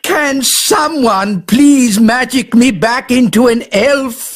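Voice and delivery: normal talking voice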